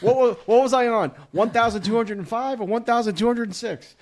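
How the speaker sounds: loud, rushed, high and frantic; mock frantic counting. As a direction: lose count and panic loudly.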